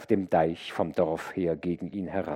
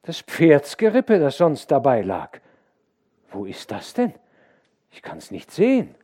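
put-on louder, higher boy telling a ghost tale; spooked boy; 6 semitones higher, 8 dB louder than usual. A narrator's reading. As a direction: let the eerie legend spill out. Boy tells the eerie legend.

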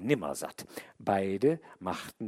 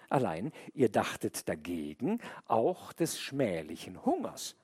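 plain reading voice

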